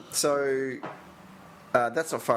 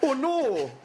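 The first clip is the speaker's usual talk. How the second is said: very high, drawn-out Canadian vowels; exaggerated Canadian accent; hardcore Canadian accent; 13 semitones higher, much slower than usual. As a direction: wail it like a cartoon Canadian commentator. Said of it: "hardcore Canadian accent".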